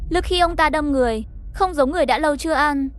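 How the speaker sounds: usual speaking voice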